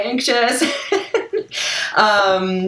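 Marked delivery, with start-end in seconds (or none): 0.7-1.9 light laugh. Real, not acted. Laughing in interview.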